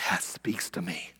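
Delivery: slow, unvoiced whispered aside; mock whisper. Pastor mimicking idle listeners, whispered and slow. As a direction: whisper it smugly, slowly. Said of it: "are like".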